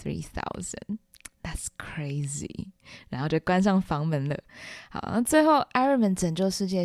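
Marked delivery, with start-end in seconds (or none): 0.0-2.7 playing it cool, hushed and drawn-out; tsundere dad. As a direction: murmur it low and soft, slow on "crazy". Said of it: "他爸很傲娇". (傲娇地小声说)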